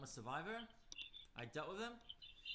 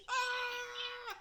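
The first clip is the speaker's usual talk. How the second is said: sudden high yelp; pained yelp; 5 dB louder than usual. Acted. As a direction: yelp as the spray hits. Fox spray hits.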